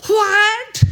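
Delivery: high, loud, outraged What; emphatic What. Shown as a demonstration. Paul rejecting the Corinthians' conduct, a high loud emphatic What. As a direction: fling it out as a shocked objection. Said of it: "can I do it one more time".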